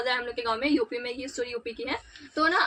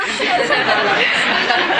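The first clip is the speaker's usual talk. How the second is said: loud, high burst of laughter; real laughing; 8 semitones higher, 9 dB louder than usual. Real, not acted. Storyteller cracking up at her own family story, laughing. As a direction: crack up loudly mid-story.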